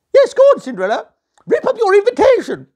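shrill-pitched jeering sister voice; squeaky bullying stepsister; high taunting ugly sister. A narrator's reading.

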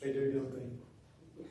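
soft, slow knowing aside. Real, not acted. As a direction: let them in on it quietly. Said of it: "aside to congregation".

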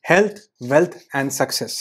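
slow, deliberate chant of the three words; slow and steady; slow affirmation. Shown as a demonstration. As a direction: say each word slowly, with conviction. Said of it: "इस चीज को repeat करें".

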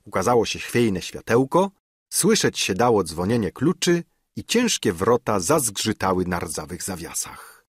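usual speaking voice

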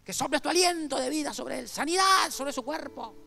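clamor de predicador, agudo y fuerte, lento al principio; Carlos Anacondia orando. Retold or imitated. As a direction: imitando a un evangelista que reprende a Satanás a gritos. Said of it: "Como es él". (el evangelista ora por el muchacho con clamor agudo y fuerte)

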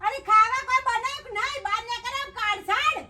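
high-pitched, fed-up scolding; nagging scold. Acted. Scolding over housework and food.

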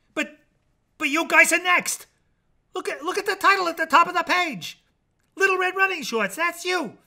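high, fast frantic plea; frantic pleading. Acted. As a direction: rush it out, begging them to start. Jack pleads with characters.

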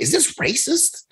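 high, soft, slow questioning voice; puzzled kid. Retold or imitated. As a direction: wonder slowly as your younger self. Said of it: "I was a kid thinking".

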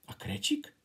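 unvoiced whisper; hushed as if telling a secret; breathless hush. A narrator's reading. Whispered narration.

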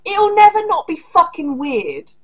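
ordinary talk

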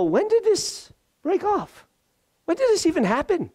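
very high, astonished question; acted wide-eyed astonishment; amazed disbelief. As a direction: marvel at it in a high, wondering voice. Acting amazement at broken chains.

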